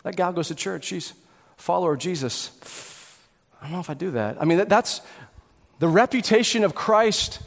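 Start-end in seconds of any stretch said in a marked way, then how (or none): 0.0-2.5 low, hushed aside about someone; softly voiced bystanders; onlookers' remarks. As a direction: murmur it like people talking behind a back. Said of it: "When people look at you and say".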